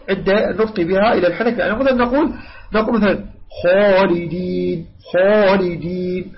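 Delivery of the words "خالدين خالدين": خالدين with a full, heavy خ raised to the palate, vowels drawn out; slow demo of خالدين, dark rounded "khaw" sound; correct heavy kha. Shown as a demonstration. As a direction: hold خالدين long with a heavy خ. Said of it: "هكذا".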